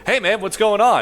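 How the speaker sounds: high, loud, friendly robot greeting; chatty robot. Acted. Robot on a conveyor belt to the newcomer, chatty. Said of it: "it's like".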